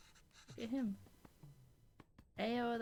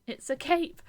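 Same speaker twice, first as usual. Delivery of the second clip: excited shout; 4 semitones higher, 10 dB louder than usual. Acted. Reading the son's defiant line.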